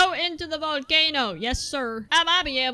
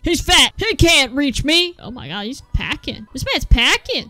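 ordinary delivery

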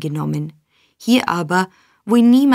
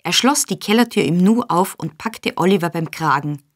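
ordinary delivery, nothing marked

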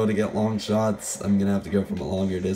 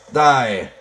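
loud shouted battle cry, pitched up; 5 semitones higher, 10 dB louder than usual. Real, not acted. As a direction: yell it at the enemy. Shouting at enemy in game.

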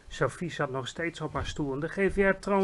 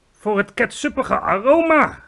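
slow, loud giant's call; 7 semitones higher, 10 dB louder than usual. A narrator's reading. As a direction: boom it out, savoring each word. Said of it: "riep de G V R". (langzaam en luid geroepen)

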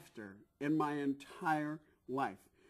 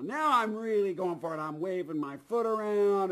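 loud, raised storytelling climax; gleeful showboating; 3 semitones higher, 7 dB louder than usual. Retold or imitated. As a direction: relive milking the laugh, louder. Acting out milking the laugh.

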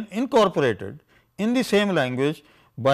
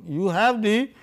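normal talking voice

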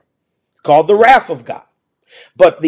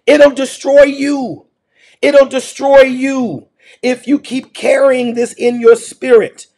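raised pitch, slow and urgent warning; preaching at full force; 5 semitones higher, slower than usual. Acted. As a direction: drive the danger home to the congregation. Preaching climax.

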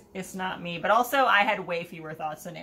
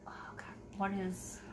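whisper; 12 dB quieter than usual. Real, not acted. Aside mid-sentence, voice drops away.

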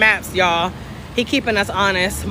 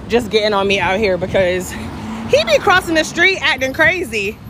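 ordinary delivery

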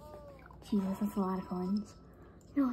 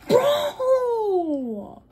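high, loud cry of disbelief, laughing; outraged yell; 12 dB louder, slower than usual. Real, not acted. Gamer losing the coin streak, laughing outraged yell. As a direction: scream it at the screen in disbelief.

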